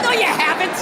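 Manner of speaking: ordinary talk, nothing marked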